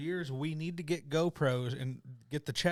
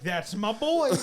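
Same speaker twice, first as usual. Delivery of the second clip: proud cheer; 7 semitones higher, 7 dB louder, slower than usual. Retold or imitated. Cheering friend's beer.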